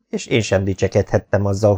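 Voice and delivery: ordinary delivery, nothing marked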